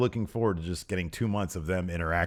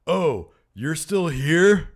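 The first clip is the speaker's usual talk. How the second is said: drawn-out, louder, higher mock astonishment; mock surprise; 8 semitones higher, 7 dB louder, much slower than usual. Host imitates a wrestler needling his rival, mock surprise. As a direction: stretch it out high in exaggerated disbelief. Acts out Corbin's jab at Ty.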